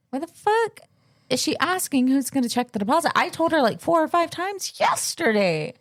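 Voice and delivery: high-pitched, incredulous acted outrage; exasperated rant, voice climbing high; retold anger. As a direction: replay your indignation, pitch way up. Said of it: "I was mad".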